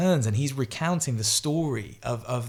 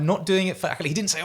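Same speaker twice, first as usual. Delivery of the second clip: ordinary delivery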